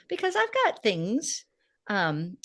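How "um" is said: ordinary talk, nothing marked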